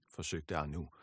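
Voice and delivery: usual speaking voice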